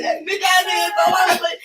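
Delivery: high, loud heated rant at the friend; angry retelling. Retold or imitated. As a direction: let the old fury flare up.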